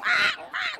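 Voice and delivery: very high newborn cry; piercing mock infant squall; imitated baby wail. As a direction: mimic a screaming baby. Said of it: "The baby's crying".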